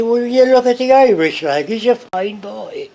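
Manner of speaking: loud, high, lilting Irish brogue. Retold or imitated. Showing how an Irish upbringing shapes speech, Irish brogue. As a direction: put on a hammed-up Irish accent. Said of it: "You speak with that brogue".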